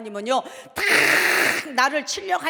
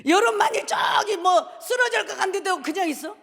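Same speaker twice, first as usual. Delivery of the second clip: ordinary talk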